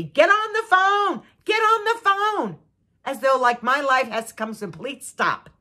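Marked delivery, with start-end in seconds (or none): 0.0-2.6 much higher, slow mock husband order; bossy husband. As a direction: imitate him barking the order, drawn out. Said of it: "he says to me".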